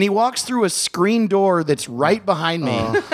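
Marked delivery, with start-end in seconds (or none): none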